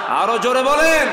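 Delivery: high, full-volume rallying shout; shouted urging. Real, not acted. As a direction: demand it at the top of your voice. Repeats the demand, louder still.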